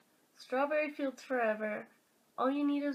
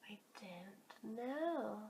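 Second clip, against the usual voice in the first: whisper; 2 semitones lower, 11 dB quieter than usual. Real, not acted. Whispered admission.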